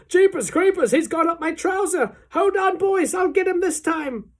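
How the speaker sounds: high, loud, frantic holler; worked-up cook yelling at high pitch; cook shouting. A narrator's reading. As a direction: holler to the others in comic panic. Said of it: "the cook shouted".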